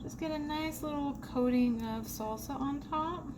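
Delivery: usual speaking voice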